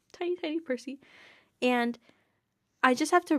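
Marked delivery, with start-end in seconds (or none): 0.1-1.0 cooing. Real, not acted. Cooing at tiny Percy.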